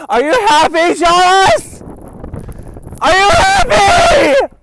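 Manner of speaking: furious yell into wind; shouting. Acted. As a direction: scream it at the storm. Yelling at storm outside.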